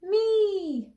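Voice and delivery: high, loud imitation cat yowl; crying out. A narrator's reading. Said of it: "Anna cried".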